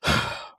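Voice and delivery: mock huff of frustration; huffy sigh. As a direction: huff like a sulking kid. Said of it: "gives, like, a huff".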